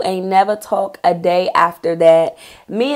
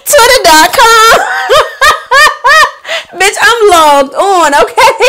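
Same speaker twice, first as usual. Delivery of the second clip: gleeful laughing; 11 semitones higher, 5 dB louder than usual. Real, not acted. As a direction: savor it like a triumphant scheme. Gleefully logging into friend's account.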